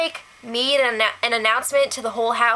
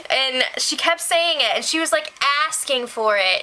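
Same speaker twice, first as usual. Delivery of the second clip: ordinary talk, nothing marked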